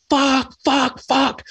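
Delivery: high-pitched exasperated cursing, word by word; hammed-up high yelps of frustration; mock frustrated cursing. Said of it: "you're just like".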